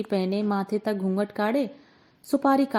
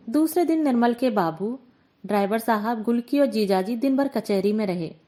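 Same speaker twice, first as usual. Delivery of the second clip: plain reading voice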